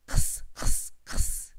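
whispered kss sound three times; isolated ks sound. Shown as a demonstration. Phonics teacher isolates the ks sound, whispered. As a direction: hiss out the ks.

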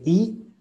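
"I" as a high, pure ee; literal I. Shown as a demonstration. Teacher contrasts vowels for student, high clear "I". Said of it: "cómo suena diferente".

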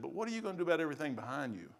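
a soft nudge from within; small still voice. Retold or imitated. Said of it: "the small, still voice in my head".

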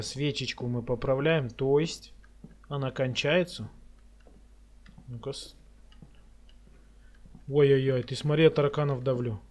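normal talking voice

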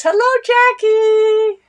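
high, slow sung-out greeting; a high drawn-out hello; sing-song hello. Real, not acted. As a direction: call it out brightly like a song. Cheery sung-out greeting to viewer.

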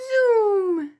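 high zooming car noise. Shown as a demonstration. Teacher zooms like a car for the kids.

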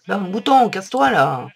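loud, exasperated cry at the button; loud angry shout at a game object; annoyed outburst. Real, not acted. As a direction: vent at it, loud. Frustrated at button in the way.

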